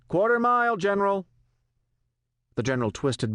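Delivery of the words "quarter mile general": pilot shouting. A narrator's reading. Pilot to the general over the helicopter noise, shouting. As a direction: call it out over engine noise. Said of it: "The pilot shouted".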